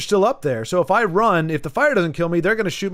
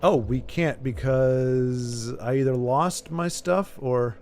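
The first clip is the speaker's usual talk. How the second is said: ordinary talk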